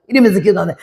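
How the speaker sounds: ordinary delivery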